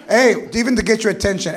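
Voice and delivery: ordinary talk, nothing marked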